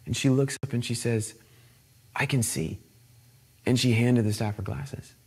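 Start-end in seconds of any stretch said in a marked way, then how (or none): 2.2-2.8 low, very soft retold revelation; quiet awe. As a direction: say it small and hushed. Retells girl's stunned quiet answer.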